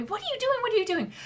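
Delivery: high, exasperated mother; scolding mother. Acted. Mother scolds her son in front of customers. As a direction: shoo the kid away.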